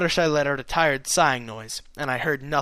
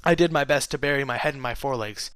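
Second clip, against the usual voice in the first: ordinary delivery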